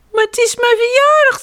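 put-on protesting child bear. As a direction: plead like an indignant small child. Child protests to sleepy papa. (kleine beer protesteert hoog en luid)

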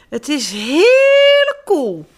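slow, high, loud gleeful call. A narrator's reading. Girl calls out to the children, slow and loud. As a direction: call out with delight. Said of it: "ze riep".

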